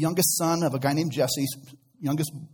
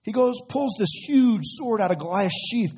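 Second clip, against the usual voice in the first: ordinary talk, nothing marked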